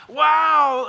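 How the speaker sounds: long, loud, high-pitched wow; showy wow. Pretend. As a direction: stretch out a booming, hammed-up wow. Voices an egotistical Peter marveling.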